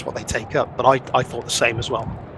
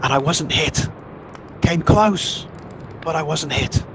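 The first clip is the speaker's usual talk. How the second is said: raised, strained voice over the gale; shouting over wind; 5 semitones higher than usual. Acted. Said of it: "he's having to shout above that wind".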